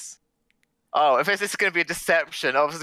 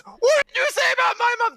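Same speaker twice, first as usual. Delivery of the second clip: much higher, offended yell; drunk taking offense; 4 dB louder than usual. Acted. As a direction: bristle at the insult. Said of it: "the idea is to turn around and says".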